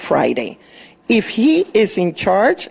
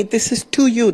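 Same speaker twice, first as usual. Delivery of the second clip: ordinary delivery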